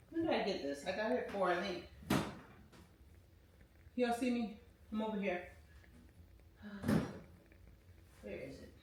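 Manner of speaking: heard from across the room; far off. Real, not acted. Vlogger searching the room, voice from far off. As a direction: talk while walking away from the mic. Walked away from camera.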